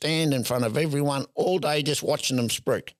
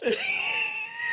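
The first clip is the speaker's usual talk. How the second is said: 1 burst of laughter. Real, not acted. Teller cracks up at own story.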